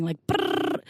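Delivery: unvoiced buzzing brrr for a rumbling mind; mimicked brain buzz. Describing her restless mind, an unvoiced brrr buzz. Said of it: "it's constantly vibrating".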